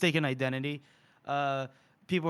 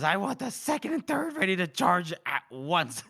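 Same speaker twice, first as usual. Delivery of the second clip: raised, angry officer's order; bursting with rage; 4 semitones higher than usual. Acted. Reader voices Mitterick ordering a charge, bursting with rage. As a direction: act out a general's furious command. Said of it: "bursting with rage".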